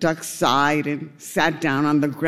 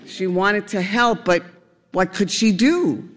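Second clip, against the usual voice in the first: plain reading voice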